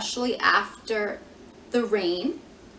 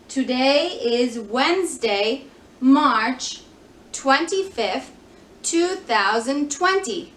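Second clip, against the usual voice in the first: put-on bright, louder teacher voice for children; broad singsong kids theatre; 3 semitones higher, 5 dB louder, slower than usual. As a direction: announce the date to little kids. Leading the class in the date.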